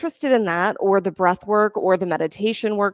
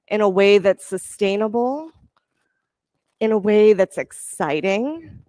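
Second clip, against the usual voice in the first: calm, steady instructor cues; yoga class cueing. Acted. Yoga teacher guiding students through poses, calm cueing. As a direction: lead the class through each pose gently.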